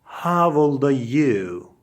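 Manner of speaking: normal talking voice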